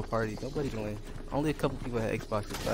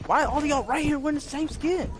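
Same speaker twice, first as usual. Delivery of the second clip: raging gamer; 13 semitones higher than usual. Real, not acted. Frustrated gamer complaining.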